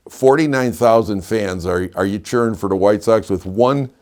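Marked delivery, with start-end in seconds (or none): none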